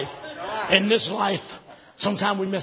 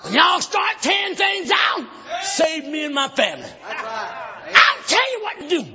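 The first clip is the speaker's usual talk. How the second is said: forceful shouted sermon with rhythmic pauses; full-force preaching; 6 semitones higher than usual. Acted. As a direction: declaim the story to the congregation.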